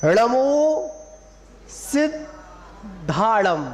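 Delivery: सिद्धाड़म very slow, break after सिद्, lean on धा; stress on DHA. Shown as a demonstration. Teacher modelling the mantra, stressed long धा in सिद्धाड़म. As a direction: hold back at सिद्, then stretch धाड़म. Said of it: "धा पे जोर देना है".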